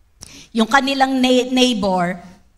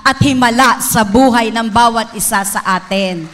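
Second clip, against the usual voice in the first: louder preaching, Jesus' promise driven home; preaching at full force; 4 dB louder than usual. Acted. Preacher proclaiming Jesus' promise to the church, loud and fervent.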